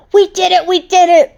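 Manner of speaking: high, loud celebration; joyful cheer. Acted. Piranha joins the victory cheer, high and loud. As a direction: yell it with joy. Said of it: "Piranha joined in".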